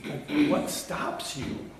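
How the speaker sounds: breathy whisper, slow; hushed, searching whisper; whispered question. Acted. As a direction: lean in and whisper it slowly. Repeated rhetorical question.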